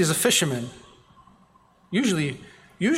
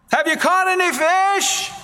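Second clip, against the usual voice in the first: higher call from the shore; 12 semitones higher than usual. Retold or imitated. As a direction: call across the water to the boat. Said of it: "yells out from the side".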